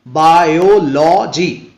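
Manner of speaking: Biology slowly drawn out, syllable by syllable; slow teaching demo of biology in separate beats; syllable-by-syllable stress. Shown as a demonstration. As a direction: stretch each part of बा-यो-लॉ-जी. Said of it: "last से जो third syllable होगा उसी पर".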